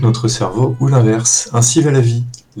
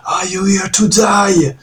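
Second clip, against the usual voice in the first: stern colonel; 9 semitones higher, slower than usual. Retold or imitated. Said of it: "il y a un colonel".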